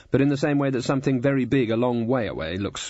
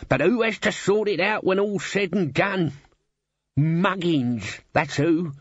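put-on servant voice, aggrieved; grumbling Albert; 3 semitones higher than usual. Albert complaining to himself at the mirror, aggrieved grumble. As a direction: grumble with rising pitch and indignation.

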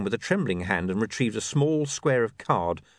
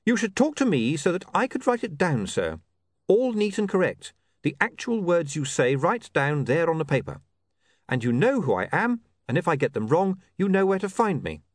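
higher-pitched, insistent character voice; persuasive young reporter; 9 semitones higher than usual. Acted. Reporter talks a watch commander into an interview, persuasive. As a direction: make the case eagerly, pitched up. William pitches writing down Vimes's words.

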